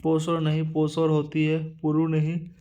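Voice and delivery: usual speaking voice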